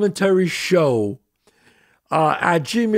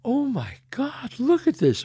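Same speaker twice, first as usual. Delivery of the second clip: imitated tearful awe; 6 semitones higher, 6 dB quieter than usual. Fiancée at the canyon, tearing up in hushed awe. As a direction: softly imitate someone welling up. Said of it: "starts to, like, tear up".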